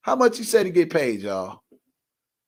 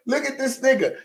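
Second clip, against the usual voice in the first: normal talking voice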